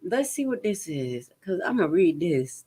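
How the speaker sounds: ordinary talk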